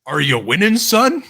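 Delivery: loud, high call across the line; to someone hard of hearing. Real, not acted. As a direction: raise your voice for a faint listener. Asking sick friend to speak up.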